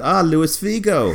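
drawn-out high surprised greeting; surprised recognition. Retold or imitated. Recognising Figo in story.

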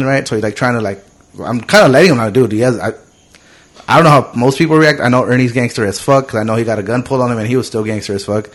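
usual speaking voice

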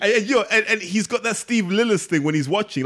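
excited. Real, not acted. Lively tangent about the referee.

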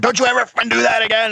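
high, fast retold scream; high-pitched mimicked outburst; imitated angry scream. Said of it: "And he screamed at him".

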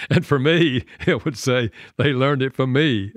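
laughing at your own fault; rueful laughing. Real, not acted. Father admitting his teen learned yelling from him, laughing ruefully.

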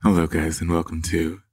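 low, soft sultry hotline greeting. Pretend. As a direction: purr it like a late-night hotline host. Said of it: "what would your voice be?".

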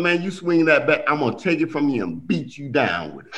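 ordinary delivery

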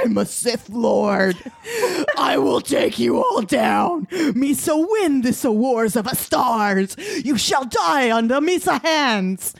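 mock shouting villain Jar Jar voice; hammed-up evil Jar Jar yelling threats; Sith Lord Jar Jar. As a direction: threaten everyone like a cartoon dark lord. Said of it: "the demise of Jar Jar Binks".

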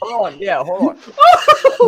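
squealing high laughter; laughing too hard to talk; hysterical laugh. Real, not acted. Cracking up at the card.

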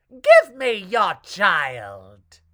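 slow, loud witch demand; menacing witch. A narrator's reading. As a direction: loom over them, slowly.

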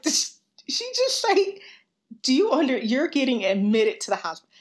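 high, halting imitation of stunned staff; mock disbelief. Mimicking staff stunned by her question.

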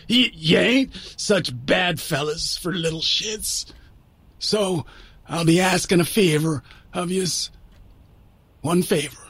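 rough, pained Irish brogue; labored, halting old Irishman; Mister McCaslin. A narrator's reading. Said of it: "McCaslin's rough, painful voice".

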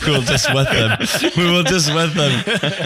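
high, slow words through real laughter; laughing, drawn-out and high-pitched. Real, not acted. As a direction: talk while cracking up. Amused by co-host's joke.

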